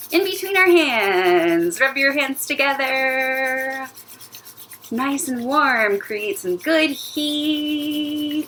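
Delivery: slow, stretched-out singsong; slow, elongated playful chant; drawn-out coaching. Pretend. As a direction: draw out each phrase while warming the dough. Coaching pretend warming, words stretched.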